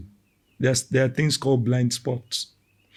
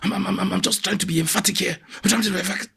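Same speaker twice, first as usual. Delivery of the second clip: high-pitched protest at moderate volume; mock shouting; 7 semitones higher, faster than usual. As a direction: imitate someone denying the shouting, voice pitched up. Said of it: "Oh, guy, you are shouting now".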